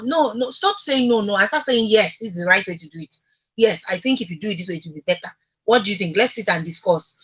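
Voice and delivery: normal talking voice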